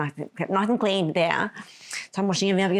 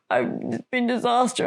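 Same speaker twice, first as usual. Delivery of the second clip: drawn-out mock wail, higher pitched; retold sobbing; 4 semitones higher, much slower than usual. Telling the hostess what happened, imitated sobbing. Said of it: "And just sobbing".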